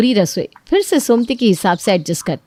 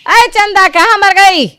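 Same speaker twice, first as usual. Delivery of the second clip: much higher, loud yelling call; shrieking call; 12 semitones higher, 7 dB louder than usual. Acted. Mother-in-law yelling for Chanda, a shrill shout. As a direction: screech for her across the house.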